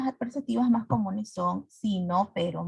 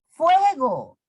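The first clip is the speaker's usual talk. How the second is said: exclaimed example; 5 semitones higher, 5 dB louder than usual. Shown as a demonstration. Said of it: "Ejemplos de intersecciones comunes".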